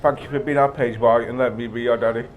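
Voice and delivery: normal talking voice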